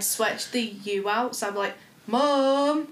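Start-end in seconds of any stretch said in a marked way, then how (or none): 2.1-2.9 louder, drawn-out Mum like a moo; calling Mum across the house, mooing; cow-like mum. Retold or imitated. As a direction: shout Mum so it sounds like a cow. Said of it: "everyone said I sounded like a cow".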